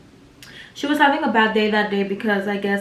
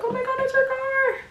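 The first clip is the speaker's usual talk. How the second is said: squealed far higher in panic; freaking out; 4 dB quieter than usual. Retold or imitated. Seeing her girlfriend's car pull up, panicked high squeal. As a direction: shriek it like you just spotted her car. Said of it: "I start freaking out".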